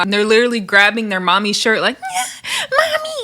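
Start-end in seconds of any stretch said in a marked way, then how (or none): 2.0-3.2 very high mock child wail; crying five-year-old. Five-year-old kid grabbing mommy's shirt, mock crying. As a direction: wail like a little kid for mom. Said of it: "crying to their mommy right now".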